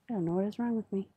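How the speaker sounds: usual speaking voice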